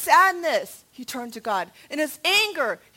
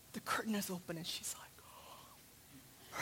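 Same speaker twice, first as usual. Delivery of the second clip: storyteller's near whisper; hushed suspense; 7 semitones lower, 20 dB quieter than usual. Real, not acted. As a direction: drop to a whisper for the reveal. Hushed build to the reveal.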